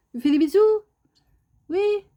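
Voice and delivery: high excited pet coo. Real, not acted. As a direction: coo for a kiss from the bird. Asking bird for kisses.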